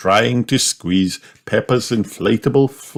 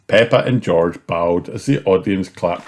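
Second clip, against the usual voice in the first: ordinary delivery, nothing marked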